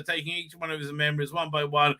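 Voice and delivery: usual speaking voice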